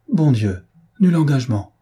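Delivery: sudden exclamation of dismay; shocked exclamation. Acted. Elinor learning there was no engagement, shocked exclamation. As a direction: exclaim it, taken aback. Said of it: "s'écria Elinor".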